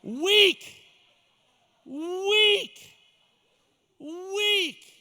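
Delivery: loud, high, drawn-out single word; ringing pulpit rebuke; scornful cry. Real, not acted. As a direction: call it out long and high, pause after each. Preacher mocks the lazy excuse.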